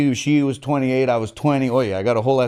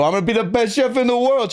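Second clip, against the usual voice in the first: mock declaration; 11 semitones higher, faster than usual. Kicking mom's door at night.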